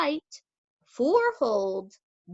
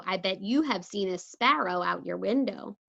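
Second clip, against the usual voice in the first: usual speaking voice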